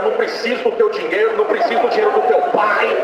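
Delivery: high-pitched, insistent pulpit proclamation; prophetic preaching. Retold or imitated. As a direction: proclaim it high and forceful, as a message from God. Preacher delivers God's message.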